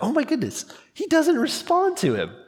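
high, amazed gasp of discovery; astonished realization. Retold or imitated. Preacher retelling finally noticing the verse, amazed. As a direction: catch yourself in sudden wonder. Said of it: "the seventh time I'm like".

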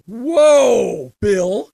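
loud mock-awed whoa with a laugh; mock amazement. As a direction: tease a friend's sudden wisdom. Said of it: "I'm like".

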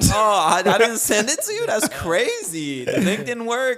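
hammed-up fake excuse to mom; feigned surprise. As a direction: play dumb in an exaggerated high voice. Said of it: "And I'm like".

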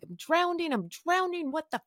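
high-pitched retold panic without shouting; retold flipping out. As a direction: act out freaking out, voice pitched right up. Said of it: "And I'm flipping out".

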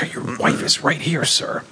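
hushed warning whisper; whispered aside. Retold or imitated. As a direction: whisper it so the wife won't hear. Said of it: "And I'd be like".

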